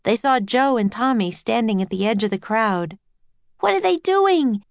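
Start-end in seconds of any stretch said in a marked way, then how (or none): 3.6-4.6 high-pitched urgent yell. A narrator's reading. Annie yelling to find out what is happening, high yell. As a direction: yell out a startled question, pitched up. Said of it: "Annie yelled".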